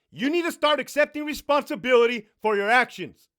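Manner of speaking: put-on angry dad shouting at his five-year-old; loud scolding. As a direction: keep yelling the lesson at her.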